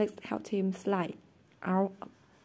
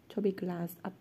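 ordinary delivery, nothing marked

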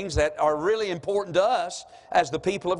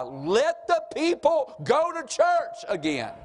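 higher, slow, drawn-out declaration; quoted proclamation; 7 semitones higher, slower than usual. Retold or imitated. Preacher quoting the president on churches, slow high proclamation. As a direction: proclaim it slowly, pitched up.

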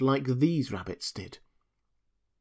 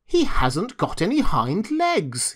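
higher, drawn-out mocking rabbit; calling out; 5 semitones higher, 3 dB louder, slower than usual. A narrator's reading. Wild rabbit exposing the toy, taunting call. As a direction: call it out slowly with swooping tune. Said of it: "he called out".

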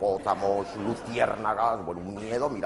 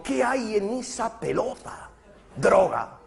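mock dialogue; 10 semitones higher, much slower than usual. Comedian acting out his own question and its answer. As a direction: ask it slowly, then blurt out the answer. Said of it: "Y yo digo".

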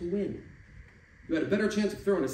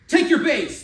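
much higher and louder ump's call; umpire yell; 12 semitones higher, 12 dB louder than usual. Acted. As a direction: bark the call across the field. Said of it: "the umpire yells".

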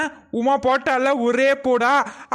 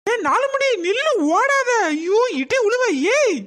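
high yell to stop her; 6 semitones higher than usual. Acted. Woman yelling at her fleeing friend, high pitched. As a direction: yell after her as she runs.

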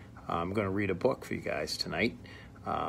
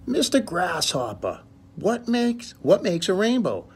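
Little Rabbit asking; 10 semitones higher, 6 dB louder than usual. Acted. Little Rabbit asks Grasshopper.

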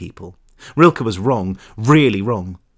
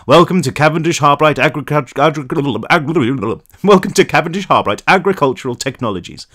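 louder recorded company greeting, tripping on the words; raised, loud phone-line announcer voice; blaring phone recording; 3 semitones higher, 3 dB louder than usual. A narrator's reading. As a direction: announce it like a switchboard message. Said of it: "a voice blasted out of the speakers".

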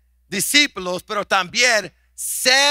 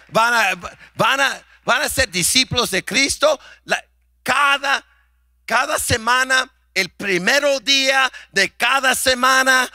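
preaching climax; 3 semitones higher than usual. Real, not acted. Pastor preaching to the congregation with rising fervor. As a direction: build to a preaching crescendo.

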